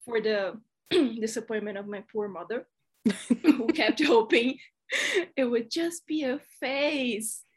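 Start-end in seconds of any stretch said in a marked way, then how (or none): none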